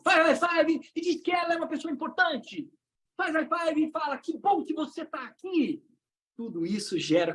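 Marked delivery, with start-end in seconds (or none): 0.0-5.8 sustained high crowd command; rallying call. Shown as a demonstration. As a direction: keep the room pumped up. Hyping the audience into exercise.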